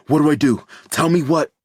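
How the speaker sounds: high, loud desperate demand; frantic father begging for orders; panicked plea. A narrator's reading. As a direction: beg for the next step. Dave pleading for instructions.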